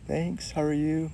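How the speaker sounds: a hint of a shy introvert answering; shy quiet guy. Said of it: "He's like".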